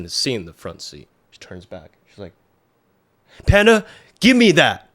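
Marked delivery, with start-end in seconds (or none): 3.5-4.9 much higher, louder alarmed command; snatch-it-now urgency; urgent demand. Retold or imitated. Girlfriend demanding the knife from Panda, much higher and louder.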